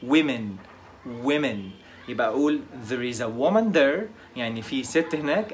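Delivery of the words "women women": women said "wimin"; plural pronunciation. Shown as a demonstration. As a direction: start "women" with a short "wi" sound. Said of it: "لكن في الجمع we". (انطق women "ويمِن")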